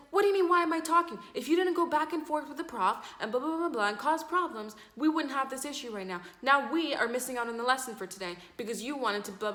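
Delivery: high, loud acted telling-off; indignant retort. Acted girl arguing back.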